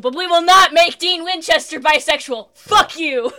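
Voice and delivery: loud, high, hammed-up refusal; mock indignant shout. Host voicing the network refusing to make Dean bisexual, mock indignant shout. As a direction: belt it out as an outraged network exec.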